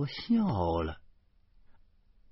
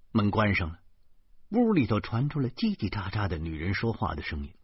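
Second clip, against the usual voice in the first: plain reading voice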